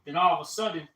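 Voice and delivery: usual speaking voice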